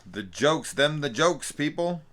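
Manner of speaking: high, loud mock showman delivery; hammed-up punchline boast; hammy comedian bit. Playing up their own pun.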